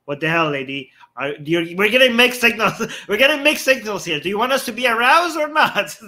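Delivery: a giggling send-up of indignant men; mock exasperation. Mocking what confused men say to veiled women, laughing.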